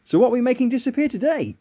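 ordinary delivery

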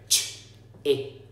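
ch then ip, split apart; segmented. Shown as a demonstration. Teacher segmenting chip into two parts. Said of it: "So you'd say".

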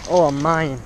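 normal talking voice

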